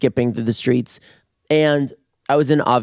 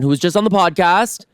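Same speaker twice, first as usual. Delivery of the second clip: ordinary talk, nothing marked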